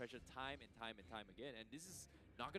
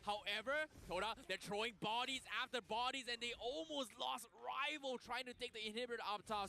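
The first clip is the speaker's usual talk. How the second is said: esports caster; 10 semitones higher, 6 dB louder than usual. Real, not acted. Excited co-caster reacts to a play.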